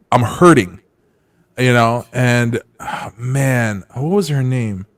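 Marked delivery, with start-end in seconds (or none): none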